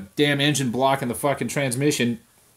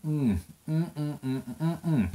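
savoring hum; 9 dB quieter than usual. Real, not acted. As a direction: hum with pleasure after the sip. Savoring the whiskey.

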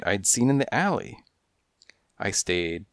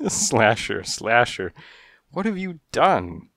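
laughing; 3 semitones higher, slower than usual. Acted. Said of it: "still laughing".

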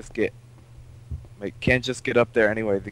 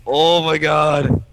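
loud, raised excited exclamation; excited discovery; 6 semitones higher, 7 dB louder, slower than usual. Real, not acted. Player finding what they were looking for, excited. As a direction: exclaim it loudly, drawing out the end.

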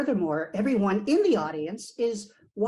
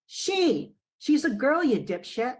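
yelled out over a crowd; loud shout; 4 semitones higher, 4 dB louder, slower than usual. Acted. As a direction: shout it across the room. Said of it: "a loud and clear voice responded".

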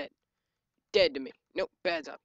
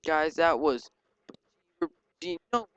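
ordinary delivery

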